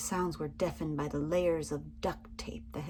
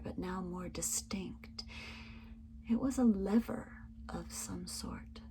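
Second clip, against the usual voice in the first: hushed whisper; 4 dB quieter than usual. A narrator's reading. Narrator telling of spotting the lever, in a hushed whisper. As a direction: breathe it out like a secret. Suspenseful escape story narration.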